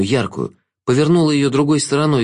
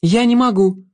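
put-on high, pleading girl's voice; high, helpless refusal; distressed young heroine; 10 semitones higher than usual. A narrator's reading. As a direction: protest in a high pitch. Girl refuses under pressure.

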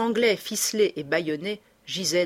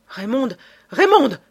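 second call much louder and higher, desperate; anguished cry; 7 semitones higher, 11 dB louder than usual. Acted. Lupin cries out to the fallen Raymonde, anguished and loud. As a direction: scream her name in horror.

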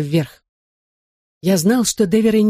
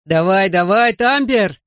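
rising, urging cheer; mild shout; 3 semitones higher, slower than usual. A narrator's reading. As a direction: cheer the dog on, climbing in pitch. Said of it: "кричал ему Бен".

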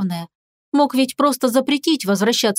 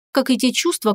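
ordinary delivery

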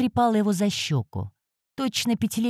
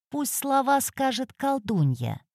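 put-on high, thin old woman's voice; 6 semitones higher, 4 dB quieter than usual. A narrator's reading. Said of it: "Старческий голос прорезал праздник".

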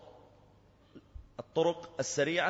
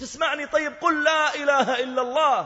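much higher, louder urgent cry; desperate shout; 13 semitones higher, 10 dB louder than usual. Retold or imitated. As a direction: shout to get through to him. Officer shouting at dying youth. (صيحة يائسة)

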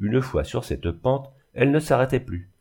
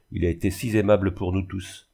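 usual speaking voice

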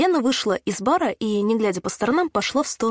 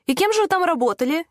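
high-pitched, needling question; snide question; 8 semitones higher than usual. A narrator's reading. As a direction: ask with mocking sarcasm. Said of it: "ехидно спросил её мужской голос".

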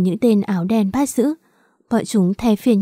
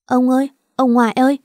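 put-on high, fast child's wail; child wailing after her grandfather, pitched higher; crying child calling out; 4 semitones higher, 3 dB louder, faster than usual. A narrator's reading. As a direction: cry out for grandpa as he leaves.

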